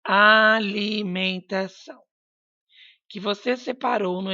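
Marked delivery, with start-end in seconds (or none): 0.0-2.0 "alimentação" slowly split into its syllables; teacher's slow, loud syllable-by-syllable "alimentação". Shown as a demonstration. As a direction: stretch "alimentação" out, one syllable at a time. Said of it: "Observe as sílabas das palavras".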